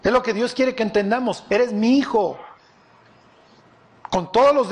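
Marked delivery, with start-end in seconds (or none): none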